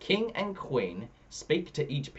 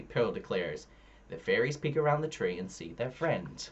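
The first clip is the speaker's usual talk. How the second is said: ordinary delivery, nothing marked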